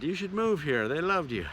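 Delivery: usual speaking voice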